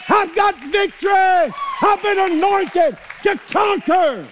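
high, loud building to a shout; preaching climax. Acted. Preacher declaring victory over the devil, shouting. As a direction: climb to a triumphant peak.

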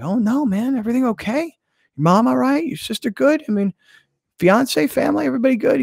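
high, anxious string of questions; mock concern. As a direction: pile up the worried questions up high. Acting his worried reply.

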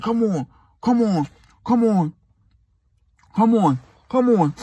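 high, slow whiny come on; mock pleading boyfriend. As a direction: drag it out like begging her back.

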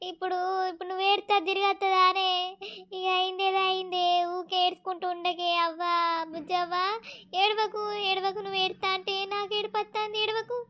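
hushed, soothing plea; soft consoling. Acted. Visiting elder tells the crying mother to stop, softly consoling. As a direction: gently coax them out of crying.

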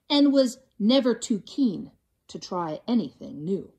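usual speaking voice

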